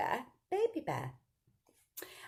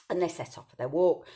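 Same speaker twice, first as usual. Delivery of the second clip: ordinary delivery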